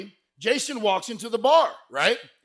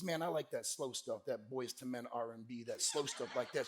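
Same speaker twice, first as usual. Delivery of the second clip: low, soft imitation of Emily; quiet, laid-back flirting; mellow answer; 8 semitones lower, 15 dB quieter than usual. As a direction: keep it low and hushed. Emily's soft answer about slow music.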